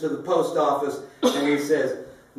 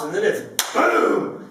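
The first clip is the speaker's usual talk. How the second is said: ordinary talk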